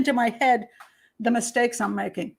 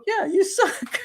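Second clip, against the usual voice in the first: teasing jab; 8 semitones higher, much slower than usual. Retold or imitated. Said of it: "the guys just go".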